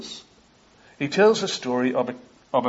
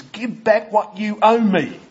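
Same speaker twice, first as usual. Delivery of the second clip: higher, louder and slow, menacing; harsh demand; 8 semitones higher, 5 dB louder, slower than usual. Acted. Forgiven servant choking his debtor, harsh slow demand. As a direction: choke the words out as a threat.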